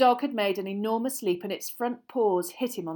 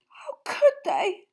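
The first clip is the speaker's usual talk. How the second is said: high, slow, strangled repeat; choked cry; 13 semitones higher, 7 dB louder, slower than usual. Acted. Hermione, horrified for Hagrid, in a choked cry. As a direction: sob it out, barely holding together. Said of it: "she choked".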